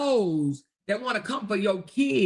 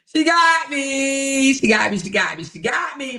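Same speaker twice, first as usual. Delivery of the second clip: loud repeated yell; 3 semitones higher, 6 dB louder than usual. Real, not acted. Streamer yelling at her chat that she got her. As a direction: yell it out, dragging the first one.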